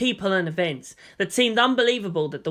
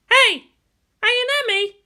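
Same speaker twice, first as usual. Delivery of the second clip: high, loud call to a teammate; girl pilot calling out; 6 dB louder than usual. Acted. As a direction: call across to her, high-pitched. Character calls out to another.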